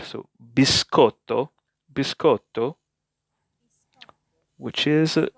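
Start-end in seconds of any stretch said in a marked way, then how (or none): 0.4-2.8 "biscotto" said bee-SKOT-to, the sc as a hard sk. Shown as a demonstration. Teacher modelling "biscotto" with a hard sk for learners. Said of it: "it sounds like, uh, S K in English".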